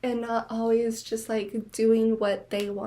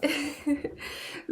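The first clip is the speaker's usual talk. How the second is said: soft laugh; 4 semitones higher, 6 dB quieter than usual. Real, not acted. Laughing at her own story.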